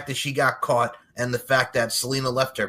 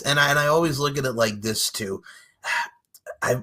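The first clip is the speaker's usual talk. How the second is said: ordinary talk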